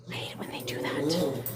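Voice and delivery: hushed whisper under her breath; soft whispered complaint; whispered aside. Real, not acted. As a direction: whisper it to yourself. Aside during dogs playing.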